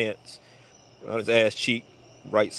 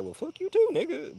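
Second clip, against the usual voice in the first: squeaky, pitched far up, sarcastic; very high sarcastic inner comeback; high mock retort; 3 dB quieter than usual. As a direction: silently tell him off in a squeak. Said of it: "In my mind, I'm like".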